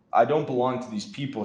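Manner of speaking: ordinary delivery, nothing marked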